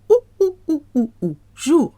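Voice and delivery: high, short monkey hoots; playful high monkey oo sounds. Shown as a demonstration. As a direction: hoot "ou" like a monkey, then "jour". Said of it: "like a monkey".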